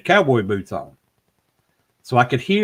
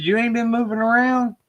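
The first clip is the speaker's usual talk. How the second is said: high-pitched incredulous question; retold disbelief; 11 semitones higher than usual. Investigator questioning his teammate, high and disbelieving. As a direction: ask it high, as if doubting her.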